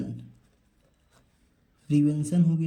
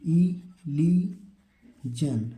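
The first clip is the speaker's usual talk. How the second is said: इलीजन stretched out very slowly, syllable by syllable; slow teacher demo of इलीजन; very slow model word; much slower than usual. Shown as a demonstration. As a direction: drag out इलीजन for the class. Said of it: "इसको पढ़ेंगे इलीजन".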